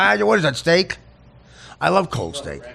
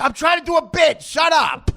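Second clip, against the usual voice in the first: real exasperated shout; 11 semitones higher than usual. Real, not acted. Host telling a guest to stop interrupting, exasperated shout.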